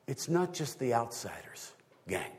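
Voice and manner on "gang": ordinary talk, nothing marked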